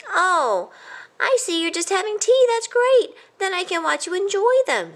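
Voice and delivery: high, bright mouse voice; eager mouse. A narrator's reading. A kind cousin eagerly watches, high and bright. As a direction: sound pleased and eager. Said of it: "Ced explained".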